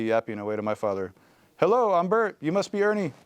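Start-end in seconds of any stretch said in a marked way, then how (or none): 1.6-3.1 Muppet voice. A narrator's reading. Toddler playing Bert to father.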